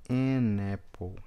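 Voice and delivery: "an apple" stretched slowly, "n" joined to "apple"; slow linked demo. Shown as a demonstration. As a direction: draw it out, no break. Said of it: "Tudo se conecta". ("an apple" lento e ligado)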